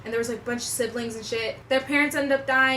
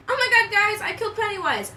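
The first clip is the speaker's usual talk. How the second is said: a higher, lighter redo of Richie's cheer; mock-thrilled friend, raised pitch; imitating Richie; 8 semitones higher, 3 dB louder than usual. Richie telling friends he killed Pennywise, lightly raised. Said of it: "I almost said Demogorgon".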